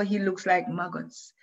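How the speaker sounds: plain reading voice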